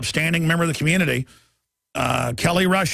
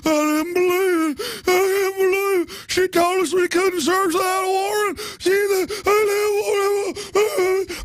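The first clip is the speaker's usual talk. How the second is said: high, slow, shouting whiny cop; whiny cop impression; 12 semitones higher, slower than usual. Host mocking cops fearing the woman, high and shouting. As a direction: wail it like a frightened dumb cop. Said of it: "They go".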